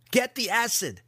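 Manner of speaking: high-pitched, urgent cry; desperate cry. A narrator's reading. Trapped captive cries to the armed apprentice, desperate. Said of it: "Alton cried".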